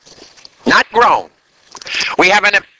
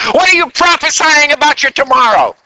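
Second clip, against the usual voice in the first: ordinary talk, nothing marked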